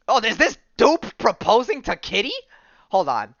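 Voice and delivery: high, loud, startled question; real surprise. Real, not acted. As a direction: blurt it out as a sudden, astonished discovery. Startled by picture on screen.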